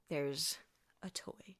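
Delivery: whispered, hushed reveal; spooky whisper. A narrator's reading. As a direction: breathe it like a secret. Spooky story beat.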